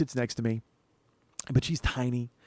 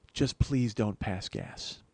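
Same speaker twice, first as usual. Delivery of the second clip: slow, hushed, low-pitched plea; pleading; 6 semitones lower, 5 dB quieter, much slower than usual. Retold or imitated. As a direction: beg softly and gravely, drawing it out. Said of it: "said it pleadingly".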